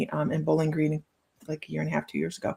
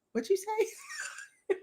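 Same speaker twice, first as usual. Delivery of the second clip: baffled; 13 semitones higher, 10 dB quieter, much slower than usual. Retold or imitated. Baffled by Southern phrase.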